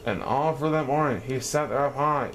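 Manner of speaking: ordinary delivery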